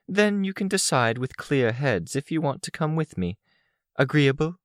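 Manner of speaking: high-pitched, businesslike goddess voice; commanding goddess. A narrator's reading. Narrator voices Goddess, a commanding figure.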